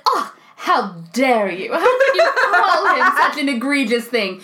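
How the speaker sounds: hammed-up outrage breaking into laughter; mock indignation. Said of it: "trying to up, up the comedy".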